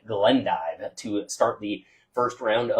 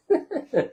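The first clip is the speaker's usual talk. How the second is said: laugh; 3 dB quieter than usual. Real, not acted. Joking he could just yell.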